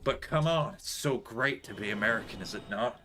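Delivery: a lighter mock American accent; toned-down American accent. Still teasing chat in a lighter mock American accent. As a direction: keep a hint of the American accent. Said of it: "Maybe a little less American".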